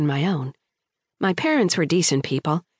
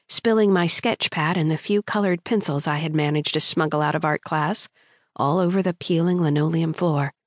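plain reading voice

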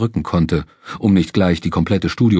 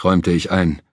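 ordinary delivery

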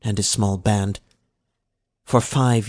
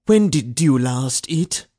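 questioning overseer; 4 semitones higher, 4 dB louder than usual. A narrator's reading.

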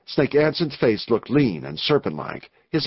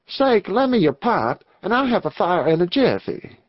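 lightly voiced outlaw, pitched higher; a hint of a frontier cowhand, voice lifted; easygoing outlaw; 6 semitones higher than usual. Jim asks the gang leader for his pipe, lightly voiced outlaw.

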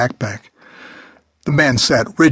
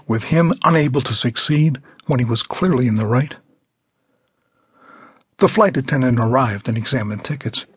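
ordinary delivery, nothing marked

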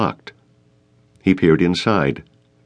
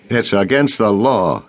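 stubborn; 3 semitones higher than usual. Acted. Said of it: "Dvorka said stubbornly".

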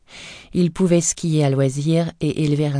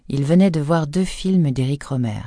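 usual speaking voice

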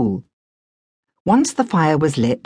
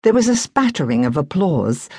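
ordinary delivery, nothing marked

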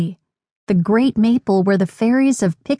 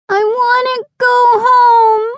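very high, louder tearful wail; crying little girl; 5 dB louder than usual. A narrator's reading. Hurt little sister wants to go home, tearful wail. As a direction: wail it out like a sobbing child. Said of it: "Gabby started to cry".

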